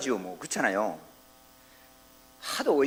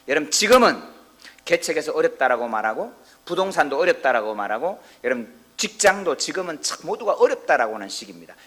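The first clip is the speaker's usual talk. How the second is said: louder preaching build; rising sermon fervor; 3 semitones higher, 9 dB louder than usual. Acted. Pastor to congregation, louder building preaching. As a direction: press each hard case home.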